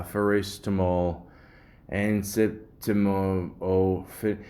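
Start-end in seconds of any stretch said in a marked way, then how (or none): none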